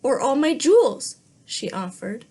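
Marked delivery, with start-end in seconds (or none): 0.0-1.2 higher and louder eager offer; eager princess offer. Acted. Princess bargains with the frog, high and eager.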